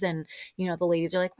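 normal talking voice

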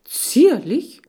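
high, loud, indignant echo of zierlich; offended hamster. Acted. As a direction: spit the word zierlich back in outrage. Hamster offended by being called dainty.

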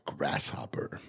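breathy whispered sponsor name. Acted. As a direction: whisper it like a secret.

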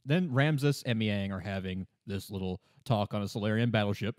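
ordinary delivery

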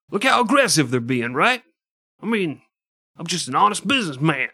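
fast, high, smiling mafia shakedown; Italian mobster voice. Retold or imitated. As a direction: play a smirking loan shark making a veiled threat. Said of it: "Is that your Italian?".

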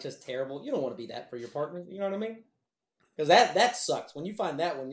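normal talking voice

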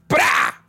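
sudden explosive tearing noise; imitated rip. Waxer rips mid-lecture, imitated rip. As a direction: burst in with a ripping sound. Rip interrupts her life lesson.

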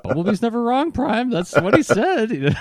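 very high, earnest cartoon voice; squeaky mock-credulous reply; naive believer. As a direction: pitch it way up, guilelessly insistent. Acting the gullible listeners' reply.

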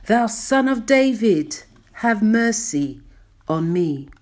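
loud, high plea for mercy, falling back at the end; preacher acting a beggar's loud cry. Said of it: "He cried".

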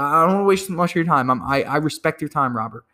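quick courteous pitch. Shown as a demonstration. Acted cold call repeat.

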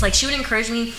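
usual speaking voice

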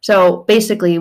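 ordinary talk, nothing marked

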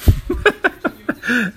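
high-pitched burst of laughter; laughing. Real, not acted. Podcaster joking about his losing team, laughing. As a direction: crack up at your own remark.

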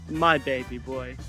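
ordinary delivery

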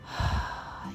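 quiet breathy sigh; soft exhale sigh. Shown as a demonstration. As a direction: let the breath go. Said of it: "Exhale it out from the root".

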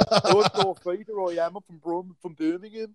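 put-on Darth Vader with a Birmingham accent; Brummie Darth Vader. Co-host showing how Vader's actor first sounded, Brummie accent. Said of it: "he sounded like a proper Brummie".

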